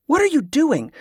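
sharp, high demand. A narrator's reading. Kerla confronts the monk casting a spell, high demanding cry. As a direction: challenge him, pitch flung up. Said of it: "she demanded".

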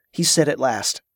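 plain reading voice

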